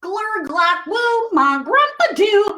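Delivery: bouncy made-up gibberish, sung in places; silly nonsense sounds. Pretend. Reading the no-pictures book aloud, silly gibberish. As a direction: play with the nonsense words. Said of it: "make silly sounds like".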